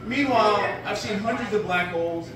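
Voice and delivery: normal talking voice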